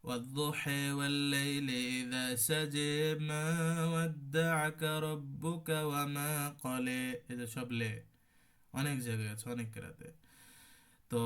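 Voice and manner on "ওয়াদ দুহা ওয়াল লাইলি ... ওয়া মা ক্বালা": slow, drawn-out chanted recitation; slow Quran recitation. Shown as a demonstration. Teacher recites the verse for students, slow chant. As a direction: recite it slowly like a qari. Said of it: "এমালা অন্যান্য কেরাতে আছে".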